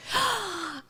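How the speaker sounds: high, sharp intake of breath; delighted gasp. Real, not acted. Reactor noticing the kitty cat, excited gasp. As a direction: gasp at spotting a pet. Spots a cat mid-recording.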